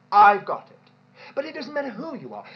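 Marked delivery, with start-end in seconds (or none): 0.1-0.8 a loud, mocking self-satisfied claim; loud mock boast. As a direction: burst out loud and smug, much louder. Voicing the self-sufficient minister.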